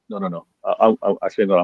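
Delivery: ordinary talk, nothing marked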